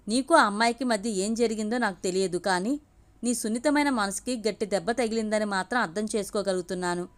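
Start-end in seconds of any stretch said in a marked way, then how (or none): none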